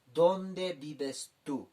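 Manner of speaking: slow, clear Spanish gloss; teacher's slow gloss; slow translation. Shown as a demonstration. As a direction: say it slowly so students follow. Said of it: "Kaukansa humaha kamta".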